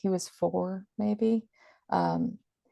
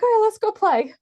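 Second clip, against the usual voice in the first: put-on much higher, louder, bright child voice; cheerful little boy; 7 dB louder than usual. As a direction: bounce up ready to play. Son cheerful after crying.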